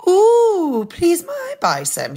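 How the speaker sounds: high, excited piggy squeal; delighted high-pitched request; little pig. Acted. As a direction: ask eagerly in a piglet's squeaky voice. Third pig asks excitedly.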